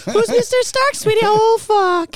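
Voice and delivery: high sweet mock wife, very high; playing a puzzled wife then cursing; high-pitched mock wife; wife's voice. Wife voice in parody.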